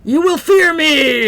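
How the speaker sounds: slow, loud laughing villain threat; menacing dragon boast. Pretend. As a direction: roar it like a dragon.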